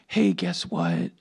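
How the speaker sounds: very low, slow delivery; imitated Maya. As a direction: break grave news in a drawn-out low voice. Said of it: "Maya looks at Cody and goes".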